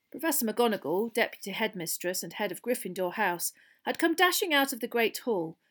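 plain reading voice

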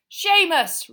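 loud, high teacher's roar; big urgent summons; roared name. A narrator's reading. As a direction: bellow the pupil's name. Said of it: "roared Professor Lupin".

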